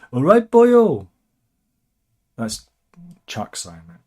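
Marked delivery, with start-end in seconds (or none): 0.1-1.1 high, loud Welsh greeting; mock Welsh accent. Presenter voicing the King greeting Drakeford, mock Welsh accent. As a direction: call it out in a hammed-up Welsh accent. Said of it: "He's saying".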